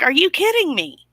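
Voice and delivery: high-pitched retold disbelief; incredulous. Host recalling her reaction to his call, incredulous. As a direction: recount it with indignant, rising pitch. Retelling own indignant reaction.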